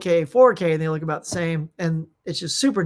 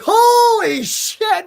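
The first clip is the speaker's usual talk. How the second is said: high, loud, slow exclamation; shocked shout; 8 dB louder, much slower than usual. Retold or imitated. Retelling his reaction to the explosion, shocked shout. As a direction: stretch out a stunned yell.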